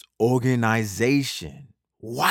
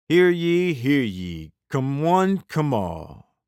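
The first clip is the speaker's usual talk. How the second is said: town crier; 5 semitones higher, slower than usual. Pretend. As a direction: call it out like a herald. Mock stadium announcement opening.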